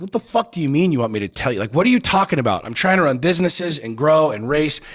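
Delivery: incredulous outburst at wife; angry retort. Retold or imitated. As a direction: fire back in disbelief, voice climbing. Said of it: "I'm like".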